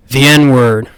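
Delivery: booming right into the mic; close to the microphone. Real, not acted. Comic bit about saying words, close to the microphone. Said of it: "get really close to the microphone".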